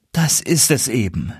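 mildly rueful sigh in the voice; a touch of sad resignation. Acted. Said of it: "seufzte der Kalif".

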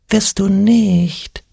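high, drawn-out little voice; thin little voice. A narrator's reading. Little voice in Ole's ear, thin and high. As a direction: taunt in a tiny high voice, stretching each word. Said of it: "flüsterte ihm ein dünnes Stimmchen ins Ohr".

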